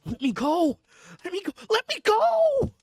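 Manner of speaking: loud, high, desperate begging; frantic pleading. Acted. Madman begging the doctor for release, frantic and loud. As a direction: plead to be let out, voice cracking up high. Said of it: "he got into quite a frantic condition".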